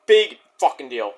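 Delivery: ordinary talk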